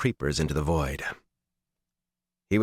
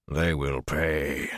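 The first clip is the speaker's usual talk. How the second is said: slow, low menacing growl; 4 semitones lower, much slower than usual. Acted. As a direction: drag each word out, threatening. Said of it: "Lews Therin growled".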